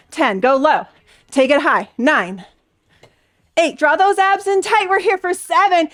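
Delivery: ordinary delivery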